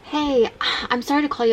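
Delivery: urgent worried phone voice. Real, not acted. Calling about missing dad.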